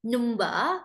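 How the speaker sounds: slow demo of a thick British accent; exaggerated old British accent, word by word. Shown as a demonstration. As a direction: draw the words out in a strong British accent. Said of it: "I used to say it like".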